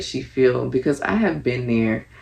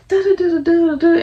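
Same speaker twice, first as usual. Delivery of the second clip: mock squeaky babble; 5 dB louder, faster than usual. Filler babble mimicking an argument.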